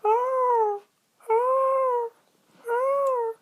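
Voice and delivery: very high squawking eagle calls; shrill bird cry. A narrator's reading. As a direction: screech like a circling eagle. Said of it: "it went".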